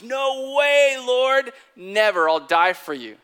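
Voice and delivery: loud, high protest, easing at the end; impassioned protest. Retold or imitated. Peter vows loyalty to Jesus at supper, impassioned protest. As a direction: cry out in acted, vehement refusal. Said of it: "Hey, buddy, you're gonna deny me".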